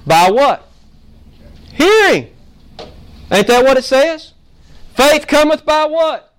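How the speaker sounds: loud, rising call-and-response preaching; full force preaching. Real, not acted. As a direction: drive it home to the congregation.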